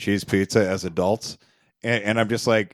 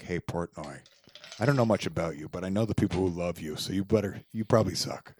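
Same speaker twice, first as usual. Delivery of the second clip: pretend comeback; 5 semitones lower, 7 dB quieter than usual. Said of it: "But I'd be like".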